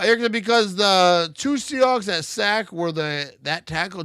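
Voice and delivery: normal talking voice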